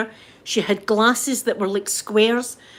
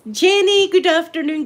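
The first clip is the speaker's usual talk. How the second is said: cheery greeting; 8 semitones higher, 4 dB louder than usual. Real, not acted. Greeting a viewer by name.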